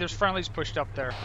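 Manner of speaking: ordinary talk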